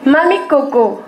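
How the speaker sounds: poem recital. Shown as a demonstration. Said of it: "un poème à vous déclamer".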